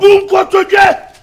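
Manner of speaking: slow, loud, high order; stern stretched-out shout; shouted command. Acted. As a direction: bellow it drawn out as an order. Father orders someone to kneel.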